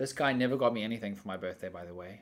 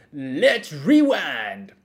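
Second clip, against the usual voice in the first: mock announcer; 3 semitones higher, 10 dB louder, much slower than usual. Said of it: "this is taking a very negative".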